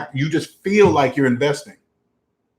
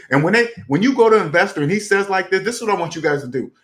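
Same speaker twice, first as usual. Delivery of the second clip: ordinary talk, nothing marked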